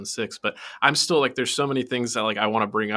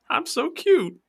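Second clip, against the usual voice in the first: very high squeaky cute voice; 3 dB louder, slower than usual. Retold or imitated. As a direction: squeal it like a cutesy kid. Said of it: "but he's like".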